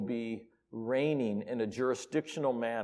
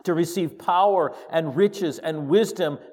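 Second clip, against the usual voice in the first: loud voice; 5 semitones higher, 9 dB louder than usual. Acted. Preacher reading the creatures' praise, loud proclamation. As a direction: proclaim it loudly like a heavenly choir. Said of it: "saying with a loud voice".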